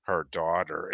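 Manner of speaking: ordinary talk, nothing marked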